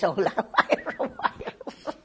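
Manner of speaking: breaking into laughter while talking; laughing. Real, not acted. Interviewee retells a friend's donkey joke, laughing. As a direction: laugh through the end of the quote.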